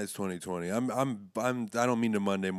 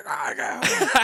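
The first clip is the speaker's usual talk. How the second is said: high loud jolting cries; mock electrocution cries; 14 semitones higher, 6 dB louder, much slower than usual. Retelling Almost Famous scene, mock electrocution cries. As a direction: cry out like you're electrocuted.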